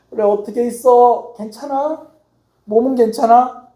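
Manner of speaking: put-on sick older brother calling. Pastor friend on speakerphone after dialysis asking after him, concerned and slow. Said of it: "나한테 전화하는 거예요".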